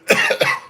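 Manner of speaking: dry hacking cough; coughing fit. Real, not acted. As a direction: cough hard, throat irritated. Spice caught in throat.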